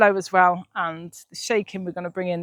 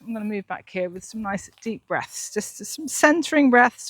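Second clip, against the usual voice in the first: usual speaking voice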